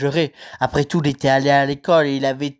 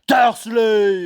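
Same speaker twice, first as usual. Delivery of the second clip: shouted name, higher and loud; shouting; 6 semitones higher, 3 dB louder than usual. Acted. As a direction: yell the name across the hut. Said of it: "hurla-t-il".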